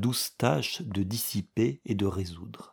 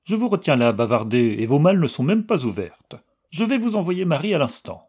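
brisk, louder, overly friendly bustle; forced cordiality; 5 semitones higher, 5 dB louder, faster than usual. Acted. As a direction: cover the hurt with cheer. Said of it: "faisant effort pour que sa voix ne trahît aucune diminution de cordialité".

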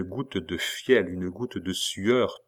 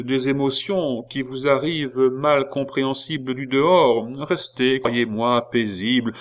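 raised, hesitant porter voice; hesitant concierge; 4 semitones higher, 4 dB louder than usual. Acted. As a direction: advise her kindly with ums. Concierge's advice in his voice.

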